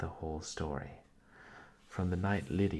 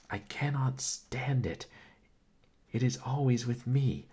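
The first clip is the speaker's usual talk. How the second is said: crying out; 7 semitones higher, 6 dB louder than usual. Acted. Sister crying out against suspicion at the library table, anguished loud outcry. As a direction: cry it out, at the end of her rope. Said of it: "she cried".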